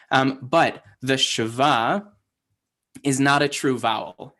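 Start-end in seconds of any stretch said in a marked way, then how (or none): none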